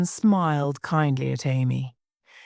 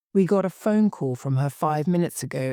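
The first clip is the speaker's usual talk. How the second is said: plain reading voice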